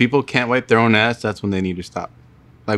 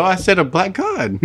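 slower, higher, and the voice cracks on "god"; voice cracking; 6 semitones higher than usual. Real, not acted. Repeating it to friends who tease him, voice cracking. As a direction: let the voice break as you repeat it defensively. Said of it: "Why your voice crack?".

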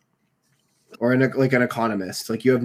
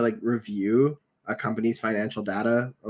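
ordinary delivery

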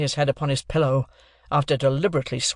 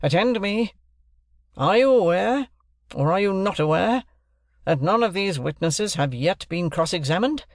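high, insistent questioning; bullying interrogation; 5 semitones higher, 3 dB louder than usual. A narrator's reading. Stranger demands one word from Wopsle, bullying. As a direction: hammer the witness for yes or no. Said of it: "in a bullying, interrogative manner".

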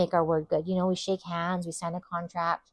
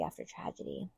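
fades down to a breathless whisper; breathy fade; 3 semitones lower, 14 dB quieter than usual. Acted. Preacher letting the phrase die away to a whisper. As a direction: let the last repeat die away in a whisper.

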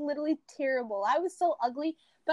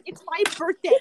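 mock birthday-girl demand, rising louder and higher; retold bossy insistence; 5 semitones higher, 7 dB louder than usual. Birthday girl making reluctant guests watch, mock-bossy. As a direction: insist on it, building to a slow, loud demand.

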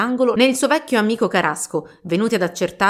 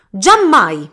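much louder, final no; flat refusal; 9 dB louder than usual. Acted. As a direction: slam the door on her plea. Father refuses daughter's plea.

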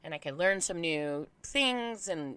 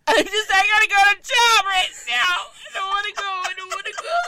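high, loud, hammed-up bawling; retold meltdown, sobbing words pushed way up; wailing cry; 12 semitones higher, 9 dB louder than usual. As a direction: wail it like a child refusing to go. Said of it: "And then I start crying".

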